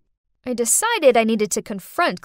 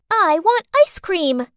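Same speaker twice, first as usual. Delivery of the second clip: put-on higher, louder and slow, a sweet child asking; little girl wanting ice cream; 7 semitones higher, 5 dB louder than usual. Little girl begging her babysitter for ice cream, higher and slow. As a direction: coax like a little girl craving a treat. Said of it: "Clarice grinned".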